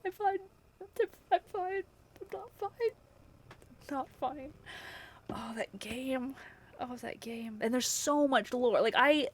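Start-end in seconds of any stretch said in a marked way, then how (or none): 0.1-4.5 much higher, squeaky voice holding back tears; mock falsetto denial. Streamer imitating herself pretending to be fine after crying. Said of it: "And I'm like". 5.3-7.6 soft whispered sigh of the words; whispered lament. Real, not acted. Remembering the game's ending, a soft whispered lament. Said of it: "whispered sigh over the game".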